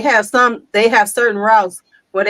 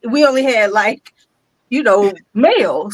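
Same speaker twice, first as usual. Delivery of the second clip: ordinary talk, nothing marked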